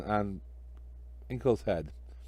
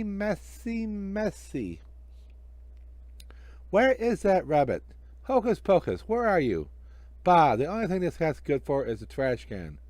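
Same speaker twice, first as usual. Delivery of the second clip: hammy stage magician, higher than the narrator; bumbling magician; 7 semitones higher than usual. Acted. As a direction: play the worst magician in the world, fumbling his tricks.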